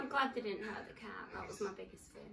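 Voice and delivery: soft, breathy whisper. Real, not acted. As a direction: whisper it with relief. Quiet moment after tense scene.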